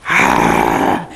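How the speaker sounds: low, hostile dog growl; raspy throaty growl. Shown as a demonstration. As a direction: rasp out a throaty growl from deep down. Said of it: "real throaty".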